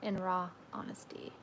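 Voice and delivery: breathy whisper, barely voiced. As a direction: whisper it softly into the mic. Reading a comment aloud.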